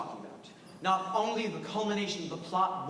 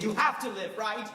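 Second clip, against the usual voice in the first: normal talking voice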